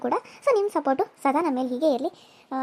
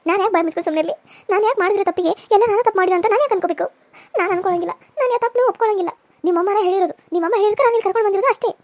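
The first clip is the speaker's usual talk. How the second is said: tearful wife arguing back, higher; crying young wife; 6 semitones higher, 6 dB louder than usual. Acted. Young wife refusing blame from husband, tearful defiance. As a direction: refuse the blame through sobs.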